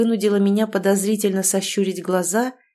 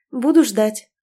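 short, clipped, louder reply; curt; 3 semitones higher, 5 dB louder than usual. A narrator's reading. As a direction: toss it off curtly. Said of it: "коротко бросила".

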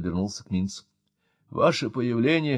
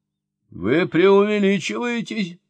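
slow, raised indignant objection; indignant protest; 9 semitones higher, 4 dB louder, slower than usual. A narrator's reading. Minz objecting to the professor, indignant. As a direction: bristle at the accusation. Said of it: "возмутился Минц".

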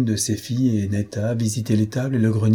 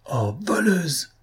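accusing cry; 8 semitones higher, slower than usual. A narrator's reading. Netta and neighbours seeing the necklace, accusing cry. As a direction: cry out the accusation in a raised pitch. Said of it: "s'écrièrent à la fois Netta et d'autres voisines".

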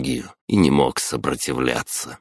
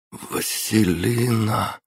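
dry, hoarse call of a name, higher and slow; rasped name; 8 semitones higher, slower than usual. A narrator's reading. Waking hero croaks a girl's name, hoarse and slow. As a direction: croak it out through parched lips. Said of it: "прохрипел я пересохшими губами".

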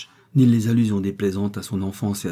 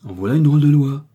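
loud, high burst of outrage; furious outburst; 6 semitones higher, 9 dB louder than usual. A narrator's reading. Victoire bursting out against the law, loud furious cry. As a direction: explode at the unfair law. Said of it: "s'écria-t-elle".

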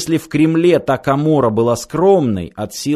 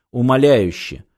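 ordinary delivery, nothing marked

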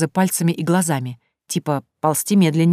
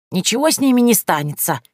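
stern reassurance; 5 semitones higher, 3 dB louder than usual. Acted. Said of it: "успокоила нас Яга, грозно хмуря брови".

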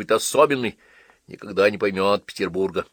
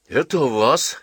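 surprised; 5 semitones higher, 4 dB louder than usual. A narrator's reading. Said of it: "с удивлением сказала Чагина".

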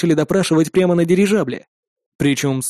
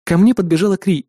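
ordinary delivery, nothing marked

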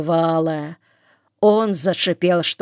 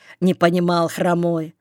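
usual speaking voice

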